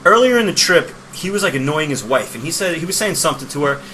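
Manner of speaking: ordinary talk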